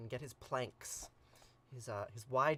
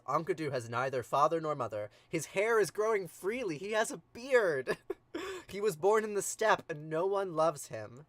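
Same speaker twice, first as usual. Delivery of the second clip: swooning description; 7 dB louder than usual. Pretend. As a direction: gush over him like a crush. Gilgamesh describing Enkidu, swooning.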